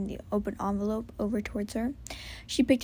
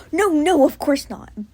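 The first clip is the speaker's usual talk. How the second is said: louder, higher hurried denial; quick reassurance; 5 semitones higher, 12 dB louder, slower than usual. Acted. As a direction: rush to reassure them. Buck quickly assuring friends.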